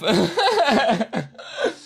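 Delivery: high laugh through gasps; breathless laugh. Real, not acted. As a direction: laugh while catching breath. Laughs after gasping.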